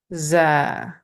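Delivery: "za" with a thin, sharp Z through a slight smile; light, sharp buzz on "za"; thin, smiling sound. Shown as a demonstration. Teacher shows the smiling position, thin sound. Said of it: "a little bit in a smiling".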